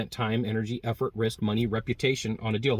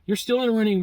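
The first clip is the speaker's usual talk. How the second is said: much higher and louder, rushed no's; flustered denial; 13 semitones higher, 4 dB louder than usual. Retold or imitated. Client nervously insisting the salesman is still in the running. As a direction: protest in a high flustered panic. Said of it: "the people are gonna be like".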